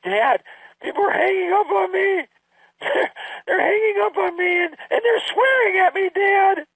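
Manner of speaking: put-on high, teary child voice breaking into sobs; imitated crying daughter. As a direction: play the upset kid crying to her dad on the phone. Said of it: "and she's crying".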